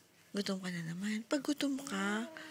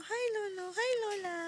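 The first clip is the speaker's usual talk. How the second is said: put-on high, soft baby-talk greeting; speaking for baby; 7 semitones higher, 6 dB quieter than usual. Parent coaching baby to greet grandparents, baby-talk. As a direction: coax the baby with little high hellos. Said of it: "Sabi mo".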